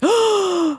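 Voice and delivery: high, drawn-in mock gasp; shocked gasp. Therapist reliving the client's seizure news, shocked gasp.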